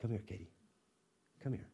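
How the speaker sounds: soft, tender come here; gentle mother. Retold or imitated. Neighbor mom gently calling daughter Katie, soft and tender. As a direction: coax the little girl in softly. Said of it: "a mom saying".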